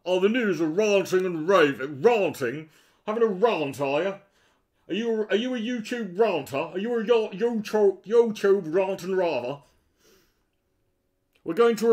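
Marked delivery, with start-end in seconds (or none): 0.1-4.1 mocking repeat. Mocking the video's phrase. 4.9-9.6 sarcastic taunt. Acted. Mocking the YouTuber.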